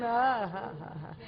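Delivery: ordinary delivery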